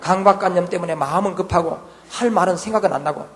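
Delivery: normal talking voice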